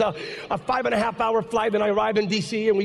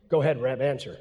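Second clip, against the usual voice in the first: low, deep senator voice; a hint of a gruff old senator; McCain's prompt; 9 semitones lower than usual. Senator urging the pastor to answer, lowered deep voice. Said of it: "Senator McCain goes".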